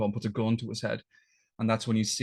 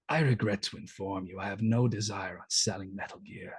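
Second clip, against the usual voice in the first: menacing Ocelot; 4 dB quieter than usual. Retold or imitated. Ocelot coolly tells the soldiers he came to take it back, hushed menace. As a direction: purr it out quietly like a cold traitor revealing himself. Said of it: "Ocelot kind of slowly turns around and goes".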